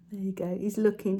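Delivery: ordinary talk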